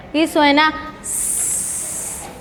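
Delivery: "S" as a long unvoiced hiss, slow; hissed S sound. Shown as a demonstration. Teacher to pupils, hissing the S sound. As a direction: hiss out the S for the class. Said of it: "Su sonido es".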